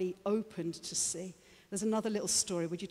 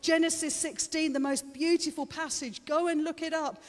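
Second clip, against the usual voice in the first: raised, ringing pitch, growing louder as it goes; impassioned preaching; 8 semitones higher, 6 dB louder than usual. Real, not acted. Preacher urging congregation to have eyes and ears opened, impassioned preaching. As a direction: drive the key words home like a revival preacher.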